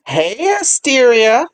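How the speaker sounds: high, loud, drawn-out hail; calling out. Real, not acted. Host picking a live listener to talk, calling out brightly. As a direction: sing out to catch someone's attention.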